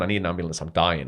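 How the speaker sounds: ordinary talk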